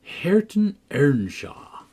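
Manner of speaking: slow, syllable by syllable "Hareton Earnshaw"; drawled by syllables. A narrator's reading. Hareton slowly spells out his own name. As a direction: spell it out slowly like a poor reader. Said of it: "He spelt and drawled over by syllables".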